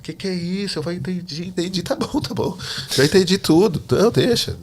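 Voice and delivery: high-pitched, emotional surrender; overwhelmed crying out. Retold or imitated. Weeping in the street, he yields to God, high and overwhelmed. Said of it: "começou a gritar assim, a chorar".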